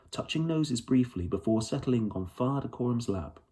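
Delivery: plain reading voice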